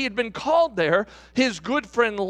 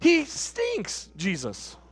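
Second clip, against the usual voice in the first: higher, exasperated protest to Jesus; doubting Martha; 7 semitones higher than usual. Acted. Martha objecting to Jesus at the tomb, exasperated and higher. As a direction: plead with him that it's hopeless, pitched up.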